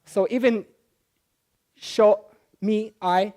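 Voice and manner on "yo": sho with a sh for the Spanish y; sho, hushing sh at the start; sheísmo. Shown as a demonstration. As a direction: say yo as sho, Argentine style. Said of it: "everything that has double L and Y becomes a sh".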